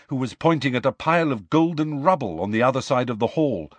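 ordinary talk, nothing marked

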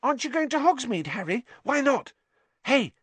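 very high, excited questioning; piping, over-keen chatter; eager fan. A narrator's reading. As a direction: pester your hero keenly. Eager young fan pesters his hero.

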